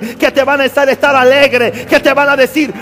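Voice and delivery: loud, high sermon voice rising to a shouted climax; impassioned preaching, building. Real, not acted. Preacher assures weary believers angels will sustain them, building to full-force preaching. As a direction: rouse the congregation with mounting force.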